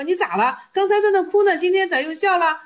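high, puzzled questioning; acted bafflement. Attendant asks the monk who cried then laughed, baffled. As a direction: question him in lifted, incredulous pitch.

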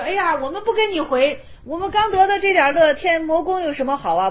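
heavenly maidens refuse to return with the demon, high and defiant. Retold or imitated. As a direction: play young maidens flatly refusing to go home.

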